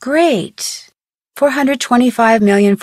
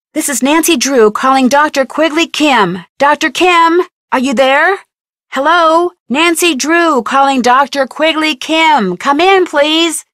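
raised, higher voice hailing someone over the radio; calling out on the radio; 6 semitones higher, 4 dB louder than usual. Acted. Nancy hails Doctor Kim on the radio, calling out loudly. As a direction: call out clearly so he can hear.

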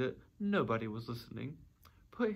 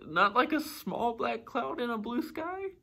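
high-pitched worried question; anxious; 10 semitones higher than usual. A narrator's reading. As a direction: ask it hoping for reassurance, pitched high. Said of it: "Pooh said anxiously".

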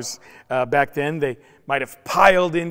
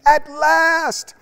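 exclaimed; 12 semitones higher, 8 dB louder than usual. Acted. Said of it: "Adam exclaimed".

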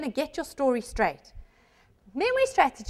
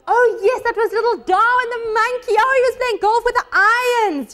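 higher, louder delighted remembering; acted excited recall; 7 semitones higher, 4 dB louder than usual. Student recalling block two in a test, excited recall. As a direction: act it out as the memory clicks back.

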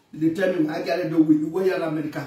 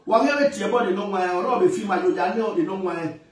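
ordinary delivery

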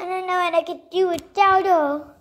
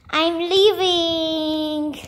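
normal talking voice

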